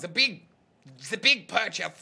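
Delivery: a light hint of a German accent; just a touch of German in the vowels. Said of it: "but in a German accent".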